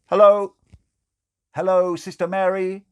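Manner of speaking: loud, high calling out. Acted. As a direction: snap her out of a daydream. Waving to get her attention.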